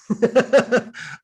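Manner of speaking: high amused burst of laughter; real laugh. Real, not acted. Telling the gym story, amused high laugh. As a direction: laugh at your own comeback.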